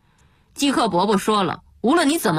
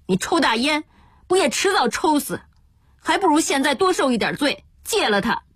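higher-pitched, insistent pleading; urgent remonstrance; 8 semitones higher than usual. Acted. Younger son cutting off father's cries, urgent exhortation. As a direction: reason with him urgently, pitch raised.

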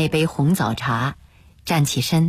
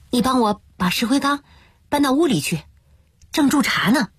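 higher-pitched bride's voice; young bride; 8 semitones higher, faster than usual. A narrator's reading. Bride asking Wu to move the lime jar, higher-pitched. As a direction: voice a young wife asking a hand.

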